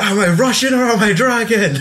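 high, laughing imitation of a teacher; hammed-up interrogation, pitched high; mock angry demand. Imitating the teacher grilling his student, high mock demand.

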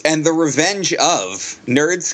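ordinary delivery

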